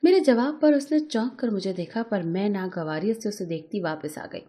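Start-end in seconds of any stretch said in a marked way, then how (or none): none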